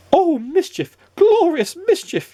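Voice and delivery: high, gleeful cry of delight. A narrator's reading. Mister Mischief to himself, squealing with glee. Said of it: "he cried".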